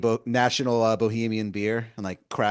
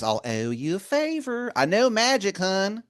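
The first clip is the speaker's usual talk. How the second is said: much higher and louder, a sweet-talking wizard calling you hun; Baltimore wizard voice; 11 semitones higher, 10 dB louder than usual. Acted. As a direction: wheedle like a pushy old wizard begging a favor. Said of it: "He goes".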